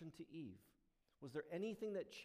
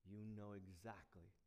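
ordinary talk

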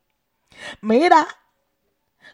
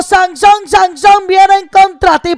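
Delilah's cry; 6 semitones higher, 5 dB louder than usual. Retold or imitated. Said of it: "Gritó".

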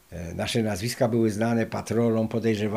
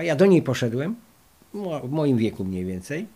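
ordinary delivery

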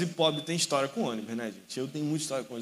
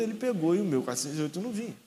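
normal talking voice

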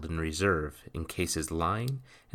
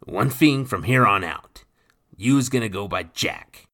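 lightly voiced gruff pirate, a touch louder; rough pirate voice; 4 semitones higher, 9 dB louder than usual. A narrator's reading. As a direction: speak like a swaggering old sea rogue. Nathan voiced.

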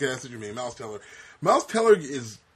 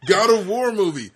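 excited pitch; 9 semitones higher, 12 dB louder than usual. Acted.

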